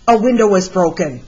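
ordinary delivery

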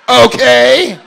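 loud, high indignant okay; outraged shouted okay; mock outraged shout. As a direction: yell it like a fed-up parent. Comic yelling at family.

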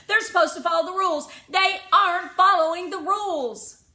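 high, loud, fast protest; indignant child. A narrator's reading. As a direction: insist fast and high like an upset kid.

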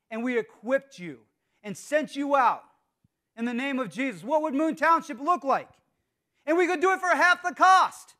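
building from firm to high, loud proclamation; impassioned preaching. Real, not acted. Preacher urging church to become missionaries, impassioned and building. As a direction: preach it, rising to a ringing peak.

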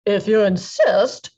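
loud, slow, heavy reply; big ape accepting the fight; gorilla voice. A narrator's reading. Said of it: "said the gorilla".

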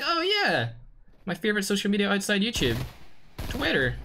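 playfully acting out his own aha moment; mock realization. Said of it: "And I was like".

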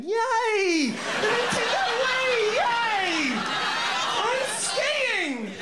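high, drawn-out squeals of delight; excited girls. Acted. Girls dreaming of Wii and skiing, hammed-up excited squeals. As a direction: cheer like overexcited girls. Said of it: "girls going".

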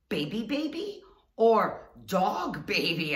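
put-on high Baby baby, then loud slow dog baby; imitating the sister. The Turkish sister asking about strollers. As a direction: quote a puzzled foreigner's broken English. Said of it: "she goes".

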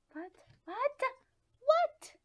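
incredulous, shrieked question; mounting disbelief. Real, not acted. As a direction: shout it in bafflement. Disbelief at the game result.

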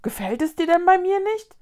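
high, rising question in dismay; surprised. A narrator's reading. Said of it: "fragte das Wesen überrascht". (überrascht und betroffen fragend)

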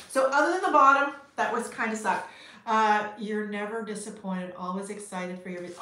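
ordinary talk, nothing marked

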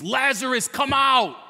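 high, very loud full-force scream; screamed command. Retold or imitated. Preacher voices Jesus at the tomb, screamed command. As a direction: bellow it as a thundering command. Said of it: "he screams".

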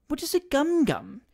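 put-on high, eager young ladies asking; eager question. A narrator's reading. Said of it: "eagerly inquired".

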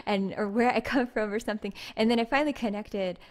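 ordinary talk

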